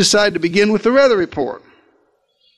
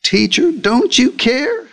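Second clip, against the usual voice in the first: high, slow, plaintive "Teacher, don't you care?"; high accusing whine; 7 semitones higher, slower than usual. Shown as a demonstration. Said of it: "accused God of being indifferent".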